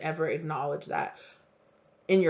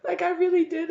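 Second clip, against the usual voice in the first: laughing joy; 12 semitones higher than usual. Acted. Laughing after imagined triumph.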